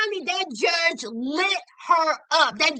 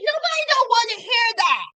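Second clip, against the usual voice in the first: exasperated; 7 semitones higher, faster than usual. Real, not acted. Venting at the mother.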